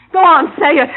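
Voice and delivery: loud taunt. Acted. Girlfriend at gunpoint daring Jake, loud taunt. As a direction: dare him to say it.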